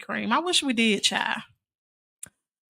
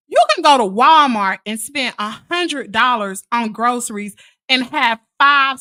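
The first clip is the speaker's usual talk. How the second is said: ordinary delivery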